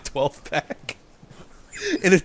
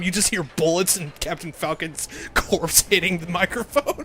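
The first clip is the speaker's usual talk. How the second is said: ordinary delivery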